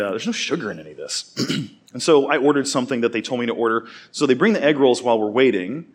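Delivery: ordinary delivery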